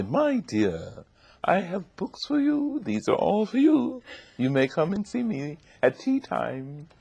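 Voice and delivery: put-on plummy old Southern lady; high, grand ladylike voice; Missus Flowers. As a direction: invite a child with plummy, high-pitched graciousness. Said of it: "All the plums in her voice".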